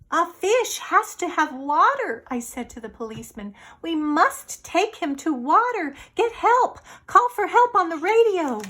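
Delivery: high, loud urgent plea; urgent boy. Acted. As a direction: push them to call for help. Boy urging policeman.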